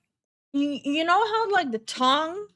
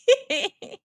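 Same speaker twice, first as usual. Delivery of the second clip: high squeal of laughter; 12 semitones higher, 4 dB louder than usual. Real, not acted. Streamer laughing after the ew reaction, a high squeal. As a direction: burst out laughing.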